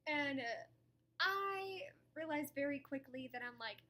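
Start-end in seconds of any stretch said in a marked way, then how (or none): none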